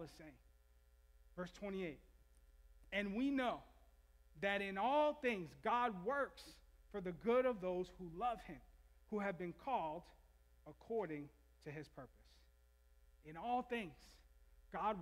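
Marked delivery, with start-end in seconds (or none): 2.9-12.1 scripture reading. Acted. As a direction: read the verse like a proclamation. Reads the scripture verse.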